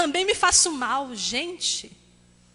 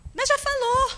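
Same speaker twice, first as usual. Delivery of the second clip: much higher, louder protest; mock objection; 13 semitones higher, 5 dB louder than usual. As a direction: squeak back a defensive excuse. Imagined objection from listener. (objeção fingida em voz bem aguda)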